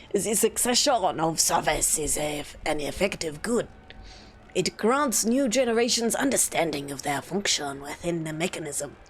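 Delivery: lightly put-on Pascal accent; formal lines in a rough Pascal accent; terrible Pascal accent. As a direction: attempt a Pascal accent, badly but eagerly. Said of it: "my terrible Pascal accent".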